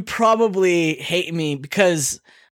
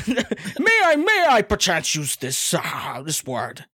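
jaunty British man; slower than usual. Acted. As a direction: beg leave like a hammed-up British gent. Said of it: "jaunty British man".

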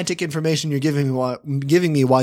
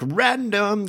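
higher-pitched, silly mockery; mock "random" catchphrase; 7 semitones higher than usual. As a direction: parody people who say it for laughs. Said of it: "when people are like".

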